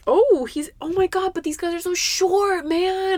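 high-pitched dismayed whine; whiny complaint. Real, not acted. Griping to a friend about short matches, high whiny complaint.

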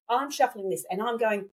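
ordinary talk, nothing marked

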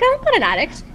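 a hammed-up, squeaky-high insistence that she's fine; squeaky denial. Retelling her coach's verdict to the host, squeaky high mock denial. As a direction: jump way up high in mock protest. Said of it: "I'm like".

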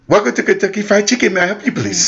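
mock polite cashier voice. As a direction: mimic the polite drive-thru worker. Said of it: "saying it like that".

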